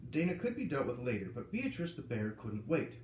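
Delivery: plain reading voice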